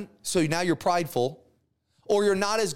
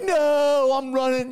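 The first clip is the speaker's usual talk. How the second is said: louder, higher panicked yelp while fleeing; panicked cry; 6 semitones higher, 4 dB louder, slower than usual. Acted. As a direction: Elijah blurting out his escape in alarm. Elijah voiced fleeing in panic.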